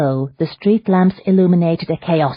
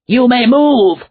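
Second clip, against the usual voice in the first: much higher, louder shouted order; commanding shout; 9 semitones higher, 9 dB louder, slower than usual. A narrator's reading. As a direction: release him with a sharp shout. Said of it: "Thaddeus shouted".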